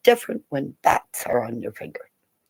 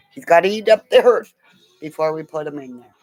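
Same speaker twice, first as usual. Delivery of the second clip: ordinary talk, nothing marked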